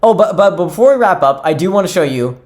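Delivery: normal talking voice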